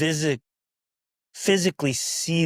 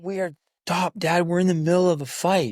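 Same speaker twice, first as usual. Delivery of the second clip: put-on kid complaining; 6 semitones higher, 4 dB louder than usual. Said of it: "Dad. Why?".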